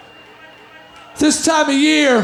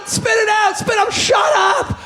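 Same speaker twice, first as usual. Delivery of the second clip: higher, snapping impatient Santa; 5 semitones higher than usual. Retold or imitated. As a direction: snap at the kid impatiently. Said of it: "He's going".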